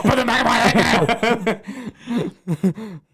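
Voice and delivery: slow laughing mock command; mock-stern demand. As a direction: lay down the law, half laughing.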